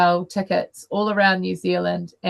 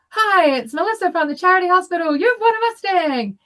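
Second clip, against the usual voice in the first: much higher and louder, beaming with news; bubbly announcer voice; 12 semitones higher, 4 dB louder than usual. Retold or imitated. Calling the winner live on radio, excited high greeting. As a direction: announce the prize like a thrilled radio host. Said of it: "And I go".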